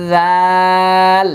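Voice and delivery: জাল held long and loud; letter contrast demo. Shown as a demonstration. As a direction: draw out জাল slowly. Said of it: "এটা মোটা, এটা পাতলা".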